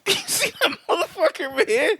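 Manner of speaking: high, laughing question. Real, not acted. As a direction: crack up while asking. Cracking up at his question.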